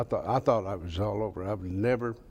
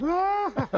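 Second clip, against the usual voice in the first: imitated shriek. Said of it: "And Jesse goes".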